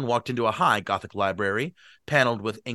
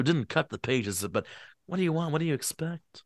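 higher voice dropping softer; tipsy man, softening; 5 semitones higher, 5 dB quieter than usual. Acted. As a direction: shrug it off confidentially. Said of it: "give Gatsby a bad voice".

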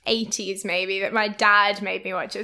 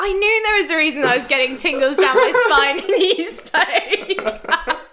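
higher and louder, breaking into laughter; laughing confession; 7 semitones higher, 5 dB louder than usual. Real, not acted. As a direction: confess it through real giggles. Amused confession about actor's voice.

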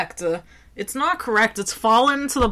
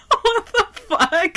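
slow, high laughing disbelief; 13 semitones higher, slower than usual. Real, not acted.

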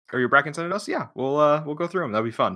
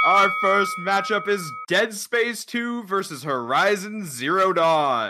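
higher, slow ring announcer call; WWE announcer voice; 6 semitones higher, slower than usual. Pretend. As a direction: introduce the matchup like a wrestling host. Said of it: "your best W W E announcer voice".

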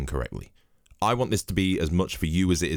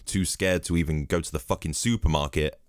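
ordinary talk

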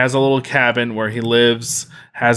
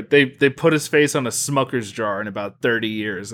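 ordinary delivery

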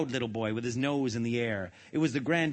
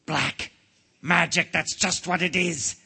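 slow, raised exclamation of realisation; relieved king; 5 semitones higher, 4 dB louder, slower than usual. A narrator's reading. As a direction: seize on the answer as a king. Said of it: "sighed the king with relief".

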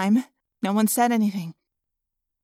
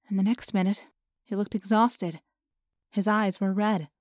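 ordinary delivery, nothing marked

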